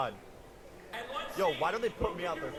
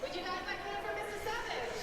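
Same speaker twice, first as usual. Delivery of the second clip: high, soft host voice; lightly voiced MC; announcer voice; 5 dB quieter than usual. Mock host introduces pro.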